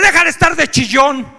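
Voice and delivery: group leader scolding a crying newcomer; stern scolding. Retold or imitated. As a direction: tell him off, no sympathy. Leader scolds him from tribune.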